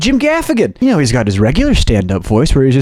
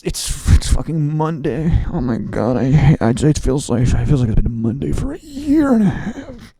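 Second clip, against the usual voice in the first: frustrated, stressed. Retold or imitated. Imitating comic moaning about Monday, stressed frustration. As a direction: struggle through it, mock exasperation. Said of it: "there was frustration in his voice".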